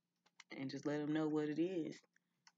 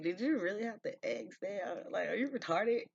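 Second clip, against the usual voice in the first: higher and louder, laughing disbelief; incredulous mockery; 7 semitones higher, 8 dB louder than usual. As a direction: scoff at an absurd question. Mocking a ridiculous question.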